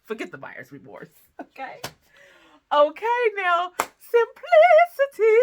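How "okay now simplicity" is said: high, loud, drawn-out "simplicity"; high drawn-out sing-song; sing-song announcement. Acted. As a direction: announce it like a game show host. Announcing the brand name playfully.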